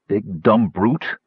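usual speaking voice

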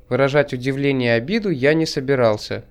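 ordinary delivery, nothing marked

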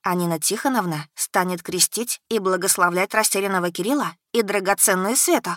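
usual speaking voice

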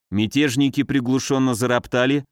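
ordinary delivery, nothing marked

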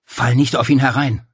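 Paul warning Claudia over the din; urgent warning. A narrator's reading. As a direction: cut in with a warning.

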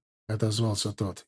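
usual speaking voice

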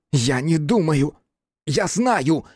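high, hateful insistence; hatred in the voice. A narrator's reading. Cripple answering his questioner, full of hatred. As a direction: spit it out with loathing. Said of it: "с ненавистью в голосе ответил калека".

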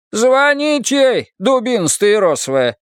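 high, loud and slow exasperated; exasperated shout. A narrator's reading. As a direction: bellow it at the fool. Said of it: "Leo snaps the answer".

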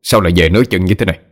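fast, irritated husband scolding; gắt lên. A narrator's reading. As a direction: fire impatient questions at the wife. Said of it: "đẩy vợ ra rồi gắt lên". (gắt gỏng, bực mình hỏi dồn)

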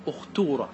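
أختورة said "ukhtuura", u then long uu; damma form. Shown as a demonstration. Teacher changing ya to waw, أختورة as "ukhtuura". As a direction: turn the middle of أختورة into a long uu. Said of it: "فتقلب الياء إلى واو".

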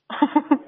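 short, high laugh; amused laugh. Real, not acted. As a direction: laugh openly at the caller. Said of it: "вам смешно?".

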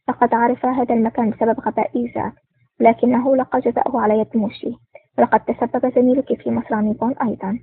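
ordinary delivery

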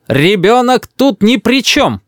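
high, slow grandmother as a tribune; orator's proclamation. A narrator's reading. Grandmother defends the child before her son, oratorical. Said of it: "говорит бабушка голосом трибуна".

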